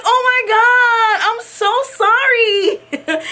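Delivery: high, slow, wailed apology; mortified apology. Retold or imitated. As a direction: cry out in hammed-up embarrassment. Mortified apology after recognizing rapper.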